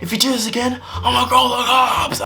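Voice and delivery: high-pitched mock-furious threat; enraged. Angry neighbour threatening boys with police, mock-enraged high voice. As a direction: imitate a raging man, building to a stretched yell. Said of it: "This guy was angry out of his life".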